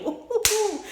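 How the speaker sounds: high-pitched laughing "ooh" hoots; excited hooting. Real, not acted. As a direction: hoot high, relishing the fight. Hoots excitedly over the fight.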